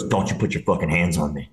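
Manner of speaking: low, restrained mock threat; low-voiced warning, acted out; retold anger. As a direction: imitate an angry guy, kept low. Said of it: "he got so fucking mad".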